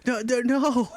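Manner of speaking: rising, panicky voice of a startled nobody; confused commoner Ragnar. Retold or imitated. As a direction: play a commoner baffled by strangers. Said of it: "just a normal Ragnar that he's just".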